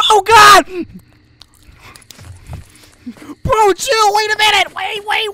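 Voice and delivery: high, loud frantic shouting; startled panic. Real, not acted. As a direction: yell at the game in alarm. Jumped by game characters.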